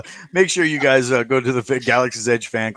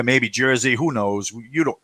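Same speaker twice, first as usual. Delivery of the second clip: ordinary talk, nothing marked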